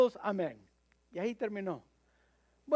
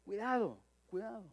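a warning fading almost to nothing; repeated warning, dropping to a near whisper; hushed warning; 7 dB quieter than usual. Acted. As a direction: let the second cuidado die away softly.

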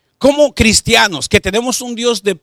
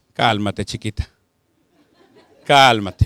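tender; 10 semitones lower, slower than usual. Retold or imitated. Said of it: "Ya, usted dígale".

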